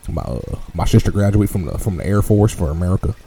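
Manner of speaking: a Texas good ol' boy telling his trip, hammed-up; mock Texan accent. Podcast host tells his Texas trip in a mock Texan accent. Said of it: "I had to get out of character for a second".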